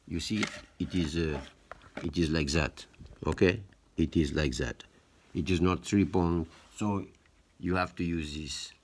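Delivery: playful put-on French accent. Showing viewers which prongs to use, in a mock French accent. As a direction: demonstrate it like a French presenter. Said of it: "You hear, like, my French accent".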